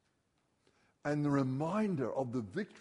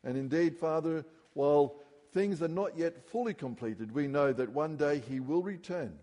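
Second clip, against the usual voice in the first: raised, earnest prayer to the Father; 3 semitones higher, 3 dB louder than usual. Acted. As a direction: lead the congregation in prayer. Closing prayer.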